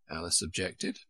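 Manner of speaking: ordinary delivery, nothing marked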